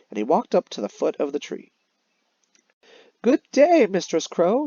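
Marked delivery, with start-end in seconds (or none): 3.2-4.7 high, loud sugary hello; fawning fox greeting. Acted. Fox calling up to the crow, high and sweet. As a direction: call up sweetly like a flattering fox.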